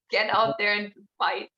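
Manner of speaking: normal talking voice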